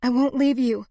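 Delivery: high, loud, pleading insistence; distressed refusal. A narrator's reading. Woman to her dying lover, high and insistent.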